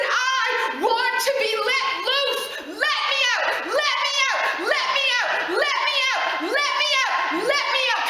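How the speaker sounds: high, loud, pleading cry to be set free; voicing the Holy Spirit. Holy Spirit begging to be released, loud high cry. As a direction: shout it over and over like someone straining to break out. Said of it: "He said".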